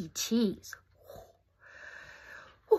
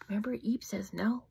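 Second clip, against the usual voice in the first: ordinary delivery, nothing marked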